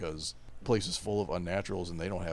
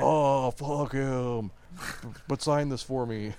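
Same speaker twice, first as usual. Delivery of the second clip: mock fan voice; 6 semitones higher, 7 dB louder, slower than usual. Crowd voiced mocking the author.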